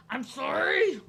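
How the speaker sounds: retold outburst apology. Embarrassed speaker apologising to rejecting friend, hammed-up outburst.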